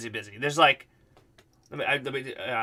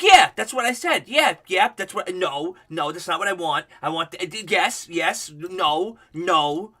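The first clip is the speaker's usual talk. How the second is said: mock fussy mom; 3 semitones higher, 3 dB louder, slower than usual. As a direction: play the bougie mom changing her order. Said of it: "the mom is like".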